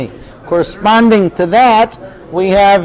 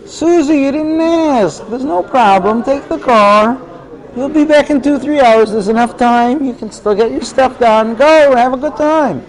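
tender giving-in dad; 6 semitones higher than usual. Acted. Father moved by his daughter's tears, tenderly higher. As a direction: melt and hand over the keys.

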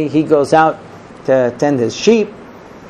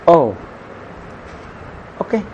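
unenthused; 5 dB quieter than usual. Acted. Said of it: "she's not too excited".

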